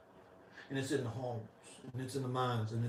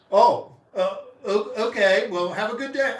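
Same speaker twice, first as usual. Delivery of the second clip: flustered cashier; 7 semitones higher, 10 dB louder than usual. Retold or imitated. Cashier thrown by a miserable answer, flustered stammer. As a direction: caught off guard, stammering, higher and louder.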